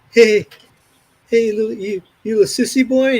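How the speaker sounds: loud, high-pitched taunt in a retold scene; ridiculing bully. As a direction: imitate a bully jeering at someone. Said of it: "ridiculing somebody".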